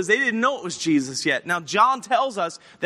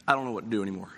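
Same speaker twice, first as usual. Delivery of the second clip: low, quiet, defeated admission; hushed and at a loss; acted dejection; 12 semitones lower, 7 dB quieter, much faster than usual. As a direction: sink down like a man out of options. Voicing a lost, defeated Peter.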